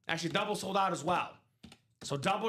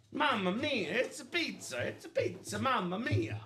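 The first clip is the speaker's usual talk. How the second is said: playful mock Italian accent, pitched up; jolly italian pizza chef; 4 semitones higher than usual. Joking about pizza boxes.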